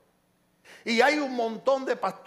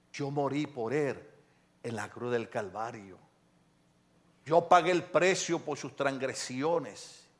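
low, slow, measured pleading; solemn intercession; 7 semitones lower, slower than usual. Acted. Christ reminds the Father he paid for sinners, solemn intercession. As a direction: remind gently, with weight on each phrase.

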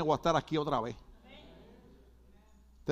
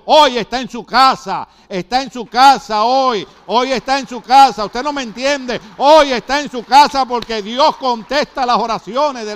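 loud proclaiming; 4 semitones higher, 10 dB louder than usual. Real, not acted. Pastor shouts to the church that the girl is home, loud and fervent. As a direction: preach it at full force, much louder.

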